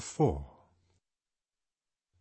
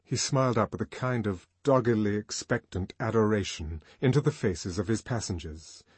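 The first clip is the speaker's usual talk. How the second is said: usual speaking voice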